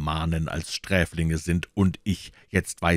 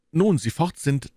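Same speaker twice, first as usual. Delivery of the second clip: usual speaking voice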